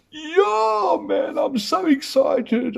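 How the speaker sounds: very high, slow, stretched yell; the groom answering his mate, squealing with mock excitement; hammed-up excitement. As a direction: overplay the giddy groom shouting his joy. Acting groom's excited reply.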